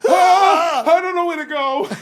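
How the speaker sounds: hammed-up scared kid stuck in the attic; panicked kid cry. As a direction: imitate a terrified child calling out in the dark. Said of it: "And I'd be like".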